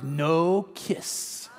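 low, soft, deliberate mock-toddler defiance; imitated stubborn refusal. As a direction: lay down the law like a tiny boss. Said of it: "looks me in the eye, folds her arm".

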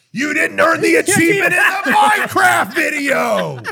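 high, loud mock outburst; indignant yelling. Kid who kicked open the door accusing them, indignant yell.

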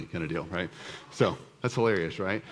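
ordinary talk

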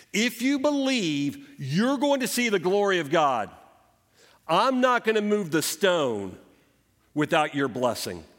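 high, loud urgent preaching; fervent exhortation. Acted. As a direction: press the promise home with fervor. Impassioned exhortation to congregation.

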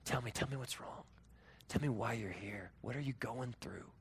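low, soft, fatherly voice; tender comforting. Acted. God wraps us up and asks, tender and soft. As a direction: gently draw out the trouble. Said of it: "He wraps us up and says".

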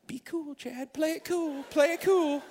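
a frantic little voice telling himself to calm down; panicky inner voice. Pretend. Panicked inner voice after rejection.

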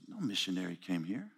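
soft, low, puzzled reply; quietly baffled, as if the idea makes no sense; confused. Retold or imitated. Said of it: "she looked at me confused".

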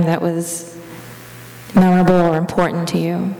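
even, level prayer-meditation voice with long pauses; guided meditation. Real, not acted. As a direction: guide them gently into the imagined scene, leaving space between phrases.

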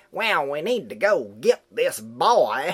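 slow, drawn-out imitation of a bad Hollywood Southern accent; lightly hammed Southern drawl mocking an actress. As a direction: mimic a grating movie Southern accent. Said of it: "But even her doing Southern".

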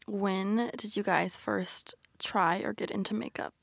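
slow, soft whispered question. Real, not acted. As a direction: whisper it like a secret.

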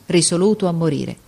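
ordinary delivery

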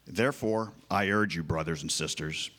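usual speaking voice

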